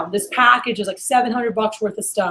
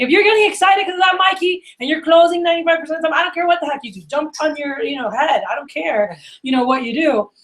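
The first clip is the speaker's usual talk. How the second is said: higher-pitched, emphatic retold outburst; exasperated go-ahead; 6 semitones higher than usual. Manager telling his salesman he doesn't care, exasperated. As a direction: wave it away in mock exasperation.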